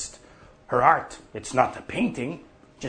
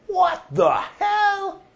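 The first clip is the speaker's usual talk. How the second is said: high, slow astonished shout; drawn-out mock dismay; startled outcry; 4 dB louder, slower than usual. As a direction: blurt it out in shock. Crowd reacting to Martin's return.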